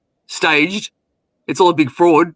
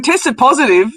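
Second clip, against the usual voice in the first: higher, incredulous echo question; mock disbelief; 4 semitones higher than usual. Host echoing woman's hospital news, scoffing disbelief. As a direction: throw it back as if absurd. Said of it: "I just started laughing".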